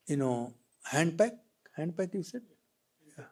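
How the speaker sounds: usual speaking voice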